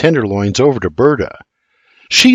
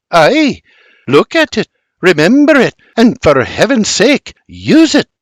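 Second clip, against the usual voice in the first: high, loud exasperated order; exasperated wife insists; exclaiming; 9 semitones higher, 4 dB louder than usual. A narrator's reading. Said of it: "Berta exclaims".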